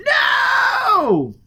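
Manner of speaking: very high, loud shriek; terrified scream. Acted. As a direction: shriek it in terror. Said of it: "Forrest screamed".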